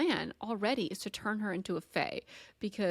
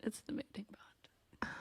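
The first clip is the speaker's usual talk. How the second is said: slow teasing whisper; 3 semitones higher, 11 dB quieter than usual. Real, not acted. Telling her friend the secret, slow and whispered. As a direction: drop to a slow conspiratorial whisper.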